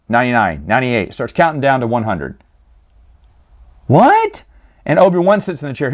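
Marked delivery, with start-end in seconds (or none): 3.9-4.4 shrill, much higher squeak of disbelief; incredulous what. Real, not acted. Incredulous at story twist.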